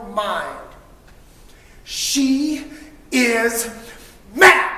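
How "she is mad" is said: slow, spaced words, with "mad" much higher and louder; dramatic pause. A narrator's reading. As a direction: hold each word, then hit "mad". Dramatic reveal of anger.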